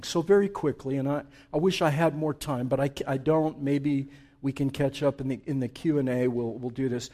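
ordinary talk, nothing marked

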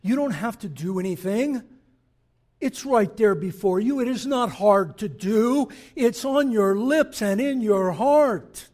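loud, rising sermon delivery; preaching. Acted. Preacher urging the congregation, full force.

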